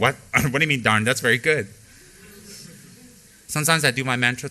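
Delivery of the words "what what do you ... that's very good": laughing, mock-incredulous comeback; amused, louder teasing reply; amused retort. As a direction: laugh it off and correct yourself. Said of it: "I went".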